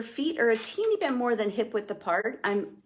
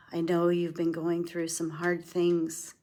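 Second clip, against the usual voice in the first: guided meditation. Acted. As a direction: guide them gently inward. Leads a self-compassion meditation.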